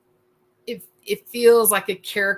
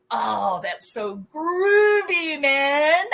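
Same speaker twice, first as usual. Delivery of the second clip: high, drawn-out hippie gushing; groovy hippie; 6 semitones higher, much slower than usual. Acted. Self-styled flower child imagining conversation, drawn-out hippie delight. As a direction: swoop up and stretch it dreamily. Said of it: "I never really said, like, in a conversation".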